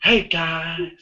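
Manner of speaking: mimicked camp greeting; James. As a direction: echo the camp vlogger impression. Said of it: "when you went like this".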